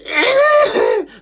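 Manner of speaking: high, loud wheezing noise; strained, high-pitched wheeze; funny wheeze. Shown as a demonstration. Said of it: "It made a funny noise".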